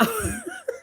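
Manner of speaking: squealing high giggle, quiet; high wheezy laugh; helpless high-pitched giggle. Real, not acted. Cracking up at a comment.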